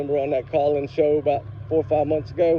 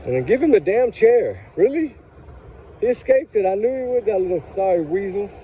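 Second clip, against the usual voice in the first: real anger, voice pitched high; high-pitched, indignant venting; mad; 5 semitones higher than usual. Real, not acted. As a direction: let outrage lift the voice sharply. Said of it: "I'm mad".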